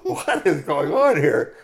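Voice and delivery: slow, loud, laughing puzzlement; baffled mama bear. Acted. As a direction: drag it out, bewildered.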